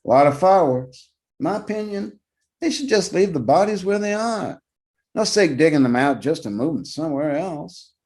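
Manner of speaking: a retired cop grumbling his opinion; old guard's voice. A narrator's reading. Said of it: "he says".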